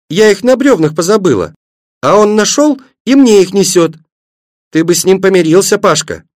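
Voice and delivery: put-on high, excited little-girl voice; joyful cry. A narrator's reading. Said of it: "радостно закричала Светлана". (радостный детский возглас)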